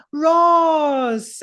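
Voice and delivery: high, loud cry of surprise; delighted shout. Acted. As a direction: yell his name in glad surprise. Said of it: "she looks at you and goes".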